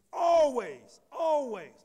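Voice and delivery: "always" loud and high, falling away; stress on always. Real, not acted. Preacher driving the word home, "always" loud and high. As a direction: ring out each "always" with force. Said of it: "put some emphasis on that word always".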